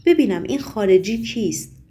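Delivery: usual speaking voice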